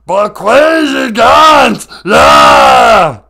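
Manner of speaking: Bakwajigan's bellowed as Cookie Monster. Shown as a demonstration. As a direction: roar the Ojibwe word like a cookie-mad monster. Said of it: "as the character I play".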